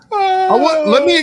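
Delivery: distorted high robotic glitch; garbled robot voice from a bad stream; booming metallic robot. Real, not acted. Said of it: "in my robot voice".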